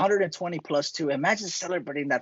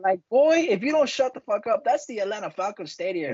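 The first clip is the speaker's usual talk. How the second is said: mock angry rant; 6 semitones higher than usual. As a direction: yell it at the rival fans. Ranting at rival club.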